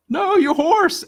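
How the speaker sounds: high, loud mock dismay at the lost horse; mock panicked cry. Neighbor cries out that the horse ran off, mock panic. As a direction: yelp it like a horrified neighbor.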